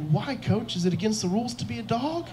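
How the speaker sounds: put-on high, soft little-kid voice; kindergartner. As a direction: ask coach like an innocent five-year-old. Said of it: "And he goes".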